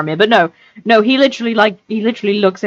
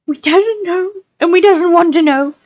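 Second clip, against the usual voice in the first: very high whimpering creature; 13 semitones higher, 3 dB louder than usual. A narrator's reading. Gollum cowering under questioning, high whimper. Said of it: "he whimpered".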